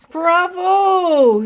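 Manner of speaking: high, loud bravo; teacher's loud cheer; delighted praise. A narrator's reading. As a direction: applaud the twins with your voice.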